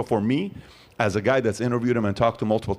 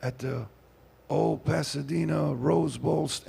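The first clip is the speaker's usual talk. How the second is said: slow, hushed preacher, slightly raised pitch; Billy Graham; 4 semitones higher, 6 dB quieter, slower than usual. Acted. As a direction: imitate an aged evangelist, soft and halting. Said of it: "This is how Billy Graham spoke".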